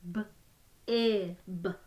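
each sound said separately with a short break; sounds tapped apart. Shown as a demonstration. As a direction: split the word into its sounds, one by one. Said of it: "Let's tap out the sounds in bib".

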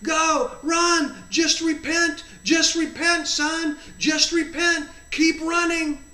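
high, loud sideline cheer. Retold or imitated. Jesus cheers the runner from the sidelines, cheering on. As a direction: shout encouragement like a cheerleader. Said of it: "almost like a cheerleader".

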